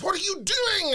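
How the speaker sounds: high, loud mock disbelief; mock outraged disbelief. London producer phoning about the missing singing, high mock disbelief. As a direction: squeal it in disbelief. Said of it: "the guy from London went".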